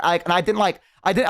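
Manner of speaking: ordinary talk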